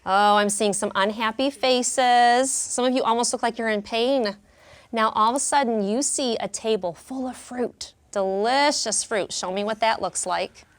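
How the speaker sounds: ordinary talk, nothing marked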